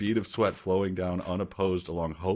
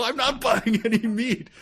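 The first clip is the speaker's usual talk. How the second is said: high, loud retold refusal; exasperated yell; 13 semitones higher, 7 dB louder than usual. Homeowner refusing the meat guy's pitch, exasperated yell. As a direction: yell it at a pushy salesman, voice jumping up.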